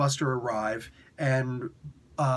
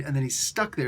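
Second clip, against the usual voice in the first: ordinary talk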